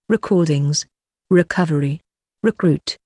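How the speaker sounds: ordinary talk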